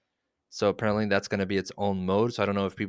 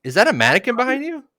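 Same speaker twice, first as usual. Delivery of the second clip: alarmed; 14 semitones higher, 8 dB louder, faster than usual. Pretend. Pretend horror scene in basement.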